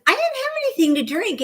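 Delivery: high, protesting denial; retelling her reply to a policeman, high and protesting; indignant protest. Retold or imitated. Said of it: "And I said".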